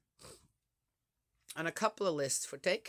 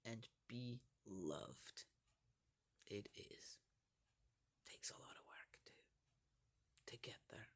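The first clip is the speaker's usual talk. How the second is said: whisper; 7 semitones lower, 19 dB quieter than usual. Real, not acted. Soft wisdom aside.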